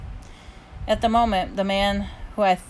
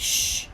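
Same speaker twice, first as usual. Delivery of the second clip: hushing. A narrator's reading. Dad hushing mom at the play, hushing. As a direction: shush someone in a theatre.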